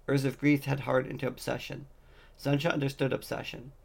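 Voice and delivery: ordinary delivery, nothing marked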